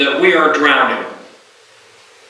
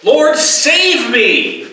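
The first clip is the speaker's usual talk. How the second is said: slow, high, pleading cry to the Lord; 10 semitones higher, much slower than usual. Acted. Peter sinking in the water, crying out high and slow. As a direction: stretch each word, begging to be saved. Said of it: "He cries out".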